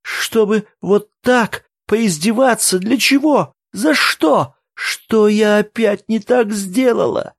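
very high, slow tearful wail; sobbing aloud. A narrator's reading. Woman asks them what she did wrong, sobbing aloud. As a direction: wail each question in distress. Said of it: "Теперь она уже рыдала в голос".

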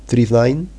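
politely asking the switchboard in English; English phone caller. Pretend. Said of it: "llamabas a la centralita". (cliente educado llamando a la centralita en inglés)

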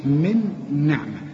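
من نعمة with the nun doubled into the next nun; slow demo of من نعمة, nun merged and held; teacher showing the merged nun; tajweed demo. Shown as a demonstration. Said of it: "تستعملش فيها لسانك إلا إذا جت بعدها نون".

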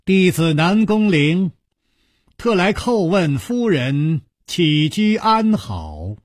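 high, drawn-out formal announcement; clear calling out. A narrator's reading. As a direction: announce yourself respectfully to someone indoors. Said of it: "朗声说道". (朗声通报)

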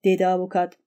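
usual speaking voice

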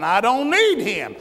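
a loud mock sinner brushing off the Lord; defiant sinner. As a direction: throw it off like a proud man refusing God.

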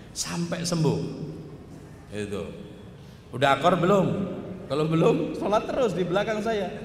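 ordinary talk, nothing marked

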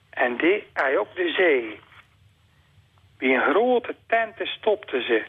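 picture book read aloud; reading to children. Acted. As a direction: read it to a toddler at bedtime, unhurried. Reads the Zeeuws picture book aloud.

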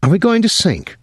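high, frightened question. Acted. Jean asking the crew, frightened. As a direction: ask in sudden fear. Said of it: "She sounded frightened".

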